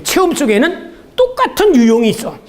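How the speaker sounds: ordinary talk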